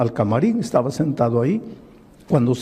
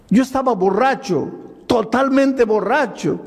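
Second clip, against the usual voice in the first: preaching build; 7 semitones higher than usual. Acted. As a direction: push the confession toward the crowd. Preacher voicing drunk's confession. (relato predicado con fervor, más agudo y fuerte)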